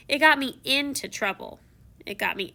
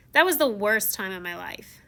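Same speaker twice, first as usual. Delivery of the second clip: ordinary delivery, nothing marked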